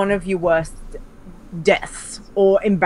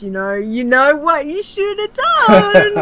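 higher, louder know-it-all inner voice; smug inner voice; 10 semitones higher, 5 dB louder than usual. Retold or imitated. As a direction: play the nagging voice in your head. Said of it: "inner voice comes back and just goes".

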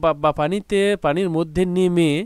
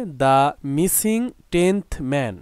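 each word drawn out slowly; slow title read; much slower than usual. Shown as a demonstration. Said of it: "এই চ্যাপ্টারটা শুরু হচ্ছে দেখো কি নামে".

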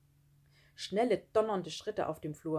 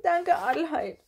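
high, loud whimpering thanks; tearful; 10 semitones higher, 7 dB louder than usual. A narrator's reading. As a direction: whine it through acted tears. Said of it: "weinte er". (weinerlich, mit hoher Stimme)

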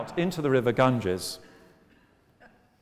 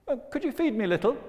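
squeaky fish voice; 12 semitones higher, much faster than usual. Acted. Fish asks for food.

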